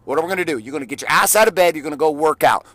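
ordinary talk

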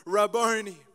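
a loud joyful cry of recognition; loud cry of joy, Aramaic; cried out. Acted. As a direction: call his name out loud, overwhelmed. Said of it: "cried out in Aramaic".

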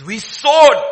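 high, loud outburst; loud confession. Acted. Brothers loudly admitting their guilt. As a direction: cry out the admission. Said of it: "He says".

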